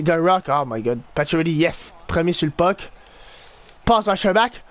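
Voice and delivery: usual speaking voice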